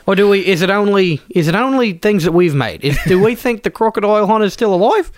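broad mock Aussie; hammed-up Aussie bloke; Australian accent. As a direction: lay on a thick Australian accent. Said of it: "doing Australian accents".

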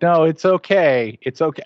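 lightly voiced sleepy wife; sleep-talking wife. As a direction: soothe him while half asleep. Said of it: "she's like".

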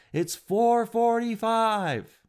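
high, very slow, drawn-out reply; lifeguard's deadpan answer. Retold or imitated. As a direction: answer dryly and slowly like a bored lifeguard. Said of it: "he said".

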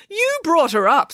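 high, emphatic reproach; dramatic wounded retort; upset accusation. A narrator's reading. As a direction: throw the blame back, high-pitched. Upset nanny blames the child.

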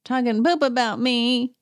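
a put-on Kyle confrontation voice; imitating Kyle. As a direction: sneer it out as a mock Kyle. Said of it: "Kyle goes up to Jeff Lewis and is like".

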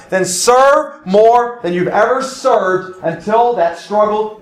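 fervent sermon exhortation; preaching at full force. Acted. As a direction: drive each charge home from the pulpit.

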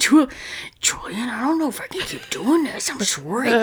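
put-on Trailer Park Boys accent, soft and slurred; slushy sh for s. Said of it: "I still can't do the accent".

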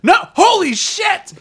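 loud, very high, drawn-out shriek; excited yelling. Retold or imitated. Fan reenacting reading the last pages, yelling with excitement. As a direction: yell it so the neighbors hear. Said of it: "literally yelling out loud with excitement".

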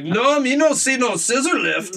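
high, slow mock broken English; drawn-out, high-pitched caricature of a denial; pregnant Russian seller. Storyteller mimicking Sandra denying the scissor lift, high and drawn out. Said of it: "She's like".

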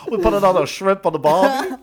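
loud, high mock Australian; Australian accent. Joining the Australian joke, loud mock Aussie accent. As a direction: ham up an Aussie barbecue line. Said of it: "That's Australian".

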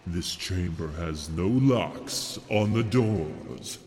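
usual speaking voice

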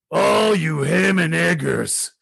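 slow, drawn-out wrestler's taunt; wrestling promo impression; Rick Rude. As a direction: stretch out every word like a heel on the mic. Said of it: "Rick Rude would come down there and he'd go".

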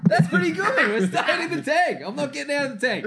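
high, excited yelling rant; squeaky high comic yelling; Kevin Hart voice. Retold or imitated. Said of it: "Kevin Hart mode and a lot of yelling and high pitched".

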